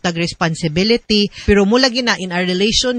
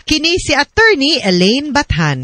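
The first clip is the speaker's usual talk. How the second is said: bright, raised announcer outro over music; radio sign-off; 5 semitones higher than usual. Acted. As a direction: close the show like a radio host thanking listeners. Radio hosts signing off programme.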